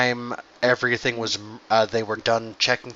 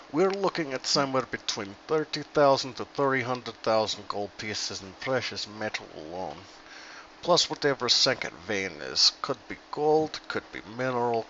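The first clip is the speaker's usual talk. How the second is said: put-on Russian dwarf accent. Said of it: "we're Russian".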